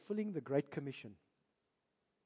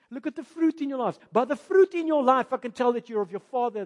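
louder, higher preaching that builds; building preaching; 7 semitones higher, 13 dB louder than usual. Acted. Jesus rebuking the religious leaders, loud building preaching. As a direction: raise it to a ringing rebuke.

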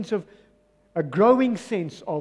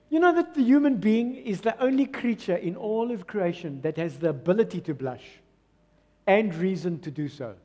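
ordinary delivery

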